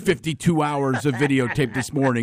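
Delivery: an overexcited football analyst; sportscaster insisting on his tape study; Ron Jaworski. Retold or imitated. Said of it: "the Ron Jaworski impression".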